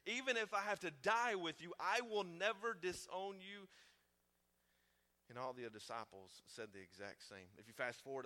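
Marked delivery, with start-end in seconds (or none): 0.0-3.7 loud, higher, slow on the end; Peter insisting. Acted. Peter insisting he will never deny Jesus, loud and high. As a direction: protest your loyalty. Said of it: "But Peter again".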